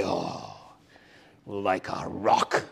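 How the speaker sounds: low, slow manly drawl, lightly put on; a hint of a rugged Southern pitchman; mock southern truck-ad voice. Preacher imitating a manly truck-ad announcer, low and slow. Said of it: "the square jaw of his and a, probably a southern accent will say".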